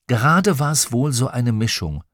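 ordinary delivery, nothing marked

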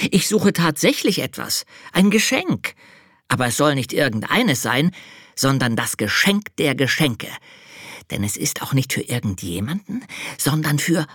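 high, eager little angel; child-like angel explaining. Acted. Angel character voice.

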